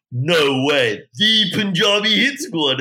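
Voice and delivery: high, slow starstruck gush; excited. Retold or imitated. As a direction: gasp out the name in awe. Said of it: "why are you getting gas".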